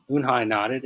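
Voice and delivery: plain reading voice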